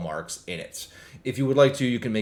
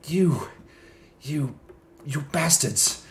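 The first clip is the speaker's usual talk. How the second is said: panicked outburst; 6 semitones higher, slower than usual. Acted. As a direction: spit it out in scared fury. Terrified mayor caught, curses them.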